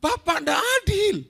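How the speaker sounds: a grown son whining that it's unfair; sulky son's protest. Retold or imitated. Elder son protesting to his father, a mild mock sulk. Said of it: "anak sulung ini marah".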